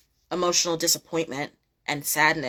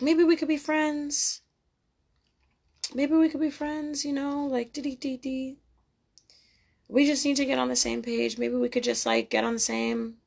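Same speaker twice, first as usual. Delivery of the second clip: mocking sweet talker; 7 semitones higher, 4 dB quieter than usual. Mocking person wanting friendship.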